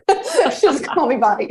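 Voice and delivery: laughing as she corrects herself; amused laugh. Real, not acted. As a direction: giggle through it. Laughing at the slip.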